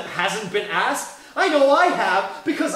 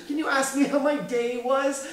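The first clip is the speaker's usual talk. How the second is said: normal talking voice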